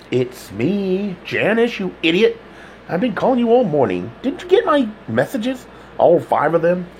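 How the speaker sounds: impatient friend, lightly voiced; lightly voiced woman caller. A narrator's reading. Janice scolding Todd for ignoring her calls, exasperated.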